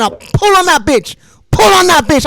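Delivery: panicked high shout; urgent shout. Retold or imitated. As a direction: shout it while yanking the brake. Yanking brake in panic.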